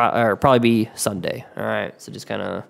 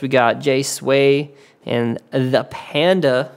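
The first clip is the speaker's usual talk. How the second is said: normal talking voice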